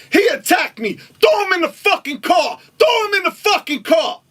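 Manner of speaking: very high-pitched frantic shout; shrieked, indignant demand; acted outrage. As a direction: imitate a victim yelling to the cops. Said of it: "Bill says".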